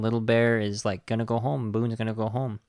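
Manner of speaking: ordinary delivery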